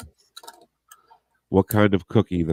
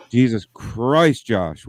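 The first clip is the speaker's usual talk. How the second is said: slow, drawn-out exasperation, louder; exasperated; 4 semitones higher, 4 dB louder, much slower than usual. Real, not acted. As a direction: stretch it out in disbelief at a friend. Friend disrupts the recording.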